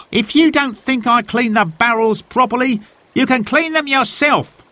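high, irritated grumbling voice; cross. A narrator's reading. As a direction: snap it back, fed up. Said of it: "He sighed crossly".

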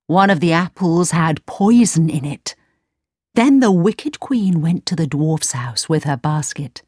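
plain reading voice

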